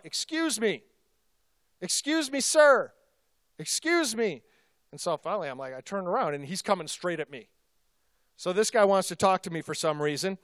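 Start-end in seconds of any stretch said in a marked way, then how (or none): 0.0-4.4 retold call across a gas station, higher and louder on sir; calling after someone fifty yards away; yelling out. Stranger at the pump calls to the pastor, mock yelling. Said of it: "starts yelling out".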